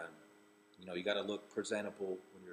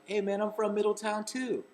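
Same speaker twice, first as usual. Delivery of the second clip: high, loud eager announcement; thrilled fan; 13 semitones higher, 11 dB louder than usual. Retold or imitated. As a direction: burst out with it, beaming. Telling star he's from there.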